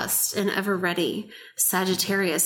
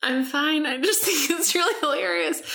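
laughing; 6 semitones higher than usual. Real, not acted. Still laughing.

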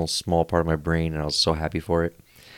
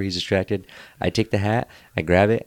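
usual speaking voice

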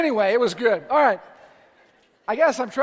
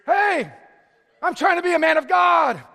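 mock irritated yell; 6 semitones higher, 4 dB louder than usual. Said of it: "freak out over your kids".

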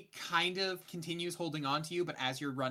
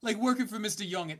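higher, louder try; second Young voice attempt; 7 semitones higher, 6 dB louder than usual. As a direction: try a different character voice. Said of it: "I don't like that voice either".

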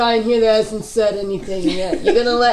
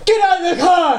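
shouted; 7 semitones higher than usual. Acted. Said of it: "Can I do that again?".

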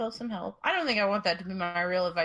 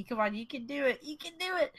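high coaxing cheer; encouraging cheer; 6 semitones higher, faster than usual. Acted. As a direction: egg them on brightly. Cheering on, pitch up.

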